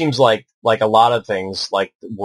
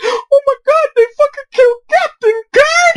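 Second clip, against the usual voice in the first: hammed-up sobbing wail, much higher and louder; crying gangster; 5 dB louder than usual. Tough gangster in the theater crying over Kirk's death, hammed-up high wail. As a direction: play a tough guy bawling in a squeaky high cry. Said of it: "he's, like, crying and shit".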